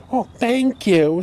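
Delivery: very high, slow simpering thank you; coy mock thanks. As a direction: mimic her fishing for compliments. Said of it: "She's always, like, fishing for compliments".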